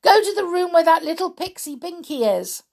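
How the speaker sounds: high, loud command; boy shouting orders to magic chair; urgent cry. A narrator's reading. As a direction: call it out in a high voice. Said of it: "Peter cried".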